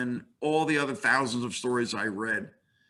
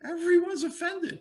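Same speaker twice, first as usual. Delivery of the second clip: much higher, put-on Lois voice; mock Lois. Professor voicing Lois scolding Superman, high put-on voice. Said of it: "Lois says".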